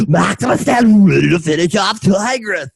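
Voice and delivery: ordinary delivery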